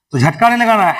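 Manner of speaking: ordinary delivery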